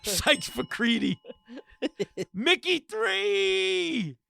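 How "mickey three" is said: mock movie title. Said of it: "hosts joking about Mickey movies".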